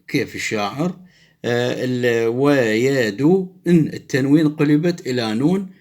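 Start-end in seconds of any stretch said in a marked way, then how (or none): none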